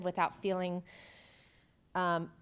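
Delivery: ordinary talk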